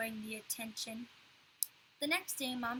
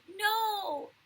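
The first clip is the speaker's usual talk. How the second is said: high, loud crying no; tearful scream; 10 semitones higher, 8 dB louder than usual. A narrator's reading. Fudge screaming through tears at Pete, tearful scream. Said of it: "Fudge screamed through his tears".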